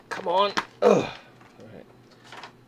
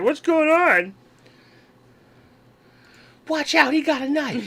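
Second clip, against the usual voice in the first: high, alarmed toy voice; panicked little figure; 11 semitones higher, 3 dB louder than usual. Retold or imitated. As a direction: voice a frightened small figure. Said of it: "He's like".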